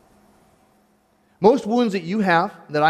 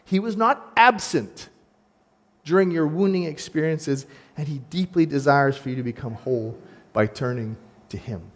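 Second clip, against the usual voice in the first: ordinary delivery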